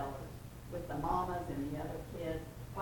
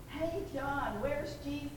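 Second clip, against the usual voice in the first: raised, higher call across the boat; calling out over a storm; imagined yell; 7 semitones higher, 4 dB louder than usual. Retold or imitated. Disciple calling to John in the storm, raised yell. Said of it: "We can imagine him yelling".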